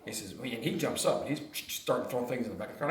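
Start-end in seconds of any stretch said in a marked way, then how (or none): none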